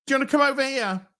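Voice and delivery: hurried imitation of customs officer; mock officer. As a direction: rattle it off like a bored officer. Officer voiced, fast and high.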